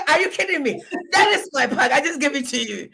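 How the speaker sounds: high, laughing disbelief; laughing outrage. Retold or imitated. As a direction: protest while cracking up. Mock outrage over plantain chips.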